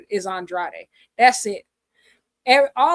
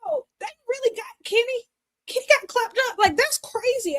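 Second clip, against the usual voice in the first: much higher, fast stunned exclamations; shocked disbelief; 12 semitones higher than usual. Real, not acted. Fan reacting to a wrestler being beaten, stunned and high. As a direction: squeal in disbelief.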